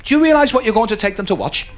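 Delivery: ordinary delivery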